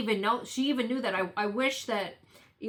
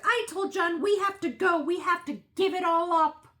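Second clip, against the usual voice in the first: high, loud mock wail; mock fake crying; 9 semitones higher, 3 dB louder than usual. Imitating her on the stand, fake crying. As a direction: imitate a put-on tearful plea. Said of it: "L P starts fake crying".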